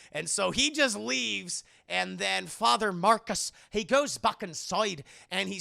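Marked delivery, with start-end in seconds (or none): none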